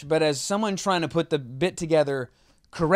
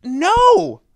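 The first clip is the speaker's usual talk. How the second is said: high, loud imitated "No!"; drawn-out high protest; exasperated mock cry; 12 semitones higher, 7 dB louder than usual. Host acting out his reaction to a flubbed line, exasperated cry.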